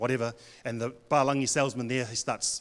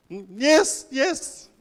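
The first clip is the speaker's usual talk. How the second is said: higher, louder polite yeses; mock parent agreeing blindly; 11 semitones higher, 6 dB louder, much slower than usual. Parent signing forms without understanding, high polite yeses. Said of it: "this is my parents".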